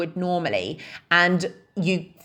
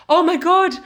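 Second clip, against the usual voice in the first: panicked pregnant woman; 5 semitones higher than usual. Retold or imitated. Pregnant woman at spin class panicking about her breath, alarmed high voice. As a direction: voice a woman sure she has lost her fitness, pitched up in alarm. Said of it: "they interpret it as".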